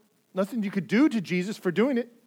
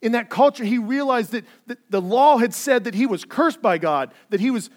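raised, louder preaching push; fervent preaching; 6 semitones higher, 5 dB louder than usual. Acted. Pastor urging his congregation, fervent and raised. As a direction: preach it with building urgency. Preacher building to full force.

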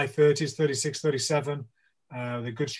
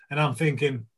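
ordinary talk, nothing marked

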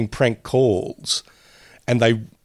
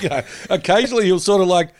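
ordinary talk

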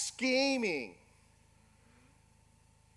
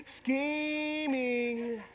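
drawn-out punchline; 3 semitones higher, 4 dB quieter than usual. Acted. Pastor drawls punchline word.

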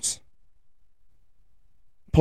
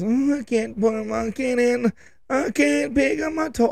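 put-on high, whiny kid voice, a touch quieter; whiny little boy; 10 semitones higher, 4 dB quieter than usual. Son whining to dad in the morning, high whiny kid voice. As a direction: whine like a little boy about his toy.

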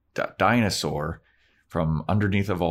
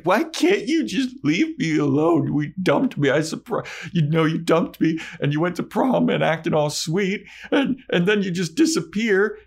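mock-tearful girlfriend, pitched way up; upset Buffy; 10 semitones higher than usual. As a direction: complain high and hurt like a jilted teen girl. Buffy upset at Angel retold.

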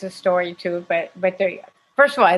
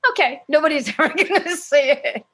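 laughing; 8 semitones higher than usual. Retold or imitated. Retold naive reassurance, laughing.

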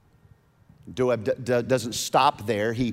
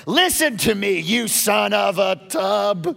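Saul's angry rebuke repeated; 6 semitones higher, 5 dB louder than usual. Retold or imitated. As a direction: hammer each word like a raging king. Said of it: "He said".

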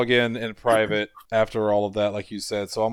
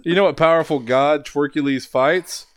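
normal talking voice

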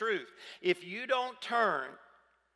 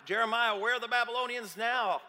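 mocking taunt; 5 semitones higher, 4 dB louder than usual. Passersby mocking Jeremiah in stocks.

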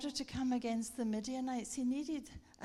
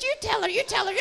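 high, loud hammed-up Birmingham voice; mock Birmingham accent; 10 semitones higher, 14 dB louder, faster than usual. Laughing Birmingham passenger who heard it all, high mock Birmingham accent. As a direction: pipe it out loudly in mock Brummie. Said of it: "She was from Birmingham".

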